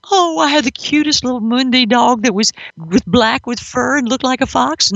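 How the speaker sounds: put-on cutesy sing-song, imitating sentimental owners; gushing pet owner. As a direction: coo it like a doting pet owner.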